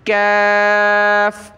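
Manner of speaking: کاف said slowly and lightly from the back of the mouth; thin small kaaf. Shown as a demonstration. Teacher showing how the thin small kaaf sounds. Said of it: "یہ پتلی چھوٹی کاف کہلاتی ہے".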